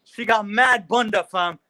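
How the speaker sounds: high, loud mock London voice; bad UK impression. Joining in the joke with a high mock London accent. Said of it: "I wish I could fucking do a good U K impression".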